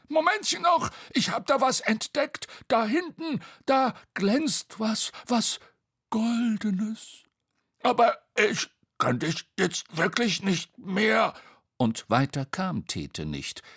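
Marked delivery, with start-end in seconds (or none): 0.1-7.2 high, excited voice ending in hush; Bo, excited discovery. Acted. Bo spotting something shiny, high and excited. 7.8-11.3 high, squeezed, effortful protest; straining to breaking point. Acted. Tete about to collapse under Bo, strained.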